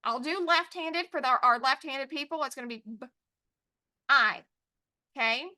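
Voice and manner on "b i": B, a pause, then long I; sounded out. Shown as a demonstration. Teacher showing left-handed tapping, sounded out. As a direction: split b-I into two separate sounds. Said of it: "tap out a word".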